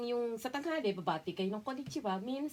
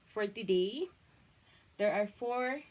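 ordinary delivery

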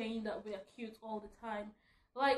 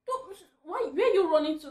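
exasperated; 6 semitones higher, 9 dB louder than usual. Real, not acted. Scolding fast walkers.

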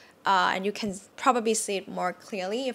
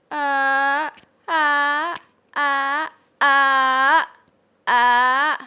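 high, loud "uh" climbing higher; female high tone; 5 semitones higher, 6 dB louder, much slower than usual. Shown as a demonstration. Teacher shows women's high tone in a soprano register. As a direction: sing "uh" up like a soprano. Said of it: "for female first".

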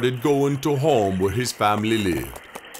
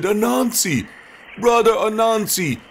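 high spirit voice; 9 semitones higher than usual. Acted. Spirits call out to Anansi.

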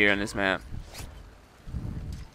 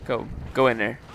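normal talking voice

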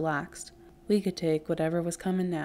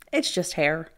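plain reading voice

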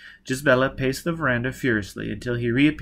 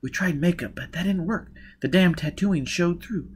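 higher-pitched woman character recounting her escape; higher voice, story told to an accomplice; Jiz, hard-edged escapee; 7 semitones higher than usual. Acted. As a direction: tell the escape in a raised character voice. Voices Jiz explaining failed disguise.

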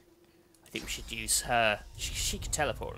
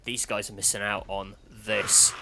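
ordinary talk

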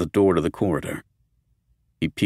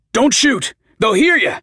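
high, loud urgent plea; urgent warning; 13 semitones higher, 5 dB louder than usual. Acted. Roger stops Steve from firing, high urgent warning. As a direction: stop him mid-shot, much higher and louder.

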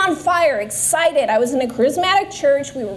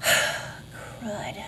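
sighed, much softer whisper of dismay; whispered groan; 5 semitones lower, 10 dB quieter than usual. Retold or imitated. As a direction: breathe it out, deflated. After the crash retold.

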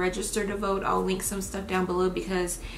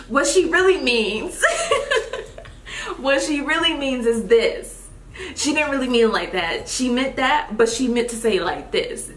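explaining friend; 4 semitones higher, 5 dB louder than usual. Retold or imitated. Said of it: "she'll be like".

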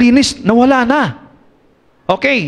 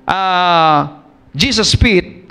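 usual speaking voice

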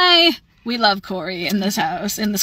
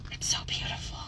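breathy whisper of awe; 17 dB quieter, faster than usual. Real, not acted. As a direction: hush it, almost no voice. Admiring the colours quietly.

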